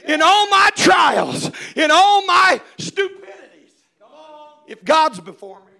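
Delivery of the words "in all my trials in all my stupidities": loud, much higher, slowed preacher's cry; full-force preaching. Acted. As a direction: shout it out like a revival preacher.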